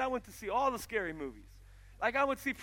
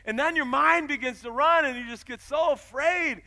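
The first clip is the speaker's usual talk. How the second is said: loud, ringing sermon build with slow emphatic phrases; preaching at full force; 7 semitones higher, 11 dB louder than usual. Acted. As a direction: drive it home to the congregation. Preaching climax.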